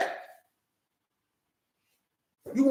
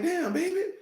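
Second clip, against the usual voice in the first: higher, mock-shocked "Damn, baby"; mock shocked man; 10 semitones higher, 6 dB quieter, slower than usual. A man mock-shocked at how his girlfriend lives. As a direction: play a stunned boyfriend, pitched up.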